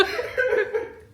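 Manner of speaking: high burst of laughter. Real, not acted. Wife cracking up at husband's joke, high burst of laughter. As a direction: let a high laugh pop out.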